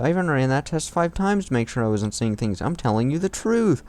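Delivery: high, insistent shouted defense; shouting back. Acted. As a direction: shout back, pleading to be believed. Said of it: "I heard Tails shouting back".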